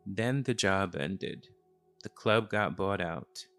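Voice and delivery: usual speaking voice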